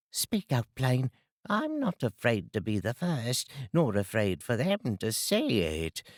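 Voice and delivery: a coarse London charwoman, pleased with herself. Acted. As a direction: cackle it out like a cheeky charwoman. Charwoman voiced in story.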